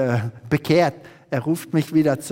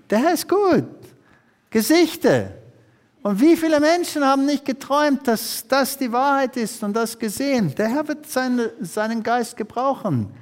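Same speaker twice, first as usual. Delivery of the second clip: higher, fervent pulpit proclamation; preaching; 5 semitones higher than usual. Acted. Preacher warns the church the end is near, fervent and raised. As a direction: proclaim the end times to the congregation.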